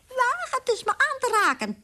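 high shrill mouse defiance; high piping warning; shrill squeak. Acted. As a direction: squeak a tiny threat. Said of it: "piepte hij schril".

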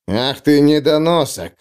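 ordinary delivery, nothing marked

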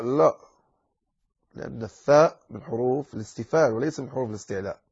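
ordinary delivery

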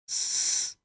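hoarse breathless whisper; raspy whisper. A narrator's reading. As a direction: rasp it out with no voice. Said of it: "вновь просипел док". (сиплый шёпот)

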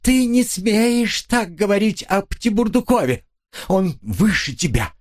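high, indignant wail; wailing outburst. A narrator's reading. Wife defends her lover to her husband, wailing. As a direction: burst out shrieking, without full volume. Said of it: "вдруг заголосила".

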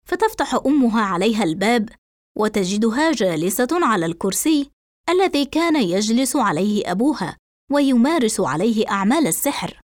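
plain reading voice

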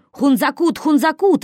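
high loud wail; yelling. A narrator's reading. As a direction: scream it at him. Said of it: "вопил Хмырь на Косого". (вопит)

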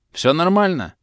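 loud, high reassuring call to the guards. A narrator's reading. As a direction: shout it over the noise to calm them. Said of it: "крикнул я". (громкий окрик охранникам)

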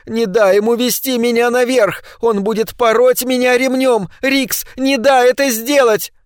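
высоким чужим голосом, медленно к концу; умоляй в страхе; мольба в ужасе. A narrator's reading. (Паддинг умоляет Рикса: высокая испуганная мольба)

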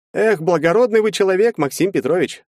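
high-pitched, sighing reproach; sighed. A narrator's reading. Lawyer to his too-honest client, sighing. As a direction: sigh it out as a resigned lawyer. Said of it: "вздохнул адвокат".

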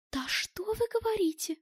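high, hushed cry of disbelief; astonished exclamation. A narrator's reading. Round-eyed woman reacting to news of a German, astonished. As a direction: exclaim softly, voice leaping up high. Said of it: "воскликнула круглоглазая".

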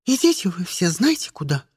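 ordinary delivery, nothing marked

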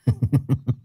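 sharp high evil cackle; a short wicked villain laugh. Shown as a demonstration. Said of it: "ba cái điệu cười phản diện".

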